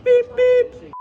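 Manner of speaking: high, loud beep beep; beep beep call. Real, not acted. His catchphrase call.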